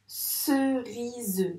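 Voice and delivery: "Cerise" slowly as ce-ri-zeu; syllable by syllable. Shown as a demonstration. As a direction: sound out "Cerise" in three even beats. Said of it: "Dans quelle".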